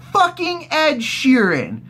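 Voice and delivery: slow, high, incredulous announcement; exasperated reveal. Real, not acted. Revealing who is singing, slow high exasperation. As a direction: drag the name out in high-pitched disbelief. Mock reveal of celebrity cameo.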